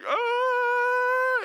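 high loud mock yelp of fright; mock scared shriek. Father told to cut the cord, mock shriek. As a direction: yelp like a panicked new dad. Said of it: "And you're scared".

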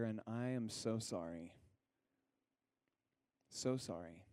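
soft, heartfelt sorry; sincere apology. Real, not acted. Pastor apologizing to those hurt by church, softly sincere.

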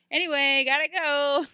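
high, loud, offhand farewell; breezy kiss-off. Retold or imitated. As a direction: toss off a cheery mock exit. Imitates Hook's breezy exit.

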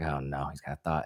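low, soft aside of dread; mock dread. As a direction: drop low, dreading what comes next. Inner dread at therapist's idea.

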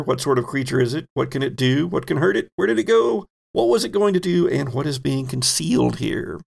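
ordinary delivery

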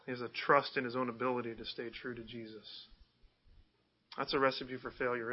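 ordinary delivery

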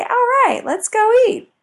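cheerful call to dog. Pretend. Cheerful speaker calling her hungry dog to eat.

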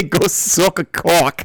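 slow, broad mock Boston; Boston accent. Host twisting the cheer for laughs, Boston accent. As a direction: drawl it with Boston vowels. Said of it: "Whenever I think Boston".

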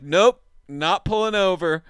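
high, loud flat no; loud mock refusal. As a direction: shut down the request firmly. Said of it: "I was like".

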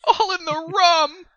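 high, loud, drawn-out lament; hammed-up yell. Player's character yelling over rats in the rum, melodramatic wail. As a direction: wail it slowly in mock tragedy. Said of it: "I'll yell".

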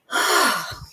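long exhaled sigh out of the body; demonstrated deep sigh; deep release breath. Shown as a demonstration. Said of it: "just like that".

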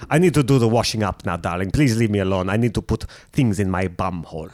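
low, put-on South African lady; a hint of a South African housewife; South African accent. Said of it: "it's very fun to do".

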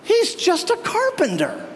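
high, sneering put-down; scoffing. Retold or imitated. Hometown neighbors mocking Jesus as a mere laborer, high scoffing sneer. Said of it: "They scoffed".

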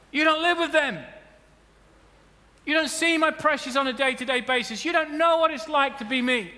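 a believer telling others they cannot understand; indignant complaint. Acted. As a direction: whine like someone nobody understands. Acted complaint, much higher.

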